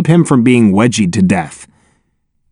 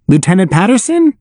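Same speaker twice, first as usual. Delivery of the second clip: higher, more insistent questioning call; raised call; 6 semitones higher than usual. A narrator's reading. Noah trying the lieutenant a second time, raised call. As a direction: call the name up higher, rising at the end.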